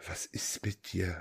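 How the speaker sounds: slow, breathy unvoiced whisper. Real, not acted. Host whispering in disbelief at his guest's answer. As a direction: hush it at him in disbelief.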